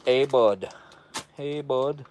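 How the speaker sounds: badly faked Canadian accent; amateur Canadian greeting; attempted Canadian accent. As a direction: have a half-hearted go at a Canadian accent. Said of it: "I can't speak Canadian".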